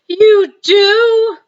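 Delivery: light up with sudden joy; delighted surprise. Acted. Giant praised by Sophie, suddenly brightening. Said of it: "cried the B F G, suddenly brightening".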